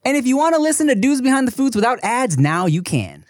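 upbeat podcast ad read, loud; bright sponsor announcement. Acted. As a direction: hosts reading the ad-free promo together.

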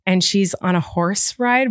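normal talking voice

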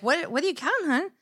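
high, innocent husband voice; innocent question. Retold or imitated. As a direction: ask it sweetly and innocently. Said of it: "innocently like".

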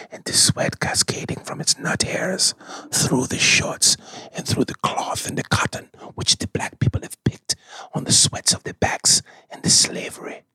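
breathy whispered fragrance commercial; whispered perfume ad. Acted. Character Jaspier whispers fragrance ad.

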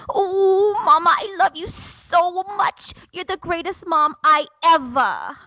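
high, loud imitation of a gushing toddler; little girl's accent. Daughter turning three hugs mom at goodnight, imitated gushing. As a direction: imitate a small child pouring out love, high and loud. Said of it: "Her little accent".

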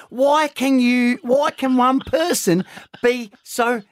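high-pitched mock scolding from a partner; Cherie. Man imitating his partner over his bumped head, exasperated. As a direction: rant in an exasperated high voice, slowing hard at the end. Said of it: "She goes".

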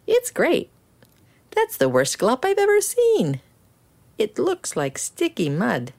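high, scornful townsfolk; mocking customers.

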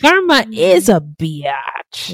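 very slow, savoured delivery; drawn-out catchphrase. Pretend. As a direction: draw out every word for comic emphasis. Repeats the catchphrase for laughs.

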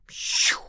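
breathy "shoo" rocket noise; voiceless mouth-made whoosh. A narrator's reading. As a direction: blow out a child's rocket sound. Boy plays rocket.

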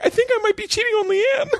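very high, laughing comic wail; hammed-up guilty cry; mock distressed squeal. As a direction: squeal it like a panicked confession. Retells guilty outburst mid-session, laughing.

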